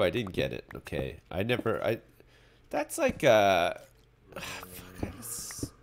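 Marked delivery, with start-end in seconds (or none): 4.4-5.7 whispered curse. Real, not acted. Streamer cursing to himself, whispered. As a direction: hiss it under the breath. Frustrated aside.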